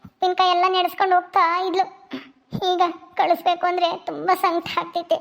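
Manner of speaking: ordinary talk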